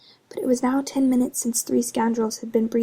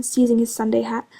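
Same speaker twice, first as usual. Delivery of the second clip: ordinary delivery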